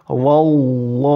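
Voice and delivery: wallahu, slow, with a thick lam; heavy lam. Shown as a demonstration. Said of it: "Yang dibaca".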